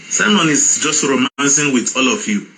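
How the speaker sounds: normal talking voice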